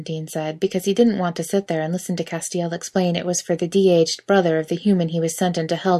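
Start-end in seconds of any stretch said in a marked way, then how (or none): none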